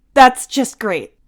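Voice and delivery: sarcastic grumble. Acted.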